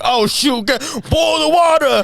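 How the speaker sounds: high, loud, frantic mock panic; squeaky hammed-up kitchen panic; mock panicked cook. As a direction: yelp it like a flustered cook in a rush. Staff panicking in retold story.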